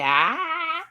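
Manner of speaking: high, drawn-out "aah"; imitated "ah". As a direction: let a long "aah" leap up high. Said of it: "They say".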